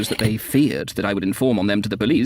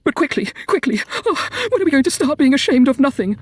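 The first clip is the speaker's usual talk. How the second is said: very high, near-hysterical pleading; impatient squealing; 4 dB louder than usual. A narrator's reading. Woman impatient to begin shamelessness, hysterical squeal. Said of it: "impatient squeals, which bordered on the hysterical".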